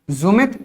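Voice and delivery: जुमित with a buzzing z at the start; जुमित, z-sound onset; wrong pronunciation. Shown as a demonstration. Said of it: "इसका नाम तो फिर क्या होना चाहिए था".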